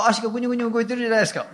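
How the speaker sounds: normal talking voice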